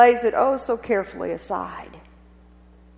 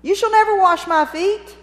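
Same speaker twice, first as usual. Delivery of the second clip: much higher and louder, insistent; loud, high, adamant protest; emphatic refusal; 8 semitones higher, 4 dB louder than usual. Acted. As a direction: plant your feet and refuse him outright. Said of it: "Peter says emphatically".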